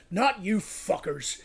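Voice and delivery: normal talking voice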